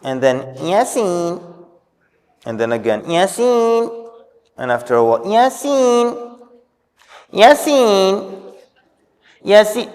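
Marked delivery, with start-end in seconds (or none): 0.6-1.5 mock calling out, much higher pitch; nervous mother calling her son Yasin, imitated high; mother calling child. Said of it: "She goes". 3.0-4.1 mock calling out, much higher pitch; nervous mother calling her son Yasin, imitated high; mother calling child. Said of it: "She goes". 5.2-9.9 mock calling out, much higher pitch; nervous mother calling her son Yasin, imitated high; mother calling child. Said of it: "She goes".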